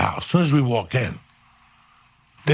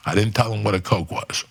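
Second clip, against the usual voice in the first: ordinary talk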